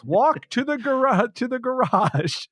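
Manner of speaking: very high, put-on guiding voice; voice of the tardis. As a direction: imitate a talking machine directing visitors onward. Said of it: "he pretends he's the voice of the tardis".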